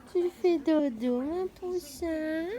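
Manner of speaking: put-on high, very slow baby talk; whispering over a crib. Baby talk to sleeping dog.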